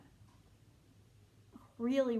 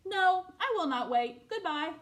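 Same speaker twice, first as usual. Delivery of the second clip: impatient refusal; 7 semitones higher, 5 dB louder than usual. Acted. Impatient animal voiced.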